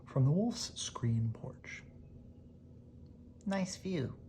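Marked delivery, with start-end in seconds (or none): 3.4-4.2 a light, higher bird voice; lightly voiced bird. Bird character voiced in story.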